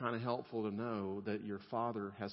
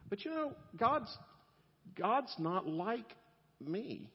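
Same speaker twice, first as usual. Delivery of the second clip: much higher and slower, teasing; playful high drawn-out aside; comic high voice; 10 semitones higher, slower than usual. Acted. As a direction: squeak it out as a self-mocking joke. Joking self-mockery after rant.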